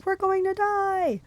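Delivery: high, drawn-out wail of doom; panicked yell. A narrator's reading. Bus passengers running in circles, panicked wailing yell.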